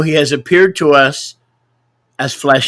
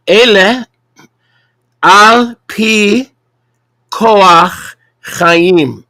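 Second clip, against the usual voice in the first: slow, deliberate Hebrew, higher and louder; over enunciating; 5 semitones higher, 4 dB louder, much slower than usual. Shown as a demonstration. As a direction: spell it out for the class. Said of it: "Let me say that slowly".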